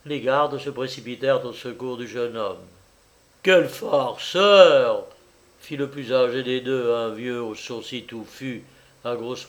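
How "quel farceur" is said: loud, slow, drawn-out exclamation; emphatic, drawn-out remark; guard's exclamation. A narrator's reading. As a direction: exclaim it loudly, stretching the words. Guard exclaims over the fainted man.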